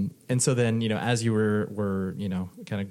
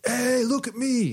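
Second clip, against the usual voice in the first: show-off voice; 5 dB louder, slower than usual. Retold or imitated. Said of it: "going in being like".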